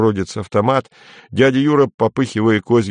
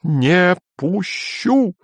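much higher, slow, hateful; 11 semitones higher, much slower than usual. A narrator's reading. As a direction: spit each word with hatred. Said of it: "сказала Сельма с ненавистью". (с ненавистью, высоко и медленно)

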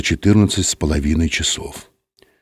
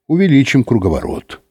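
plain reading voice

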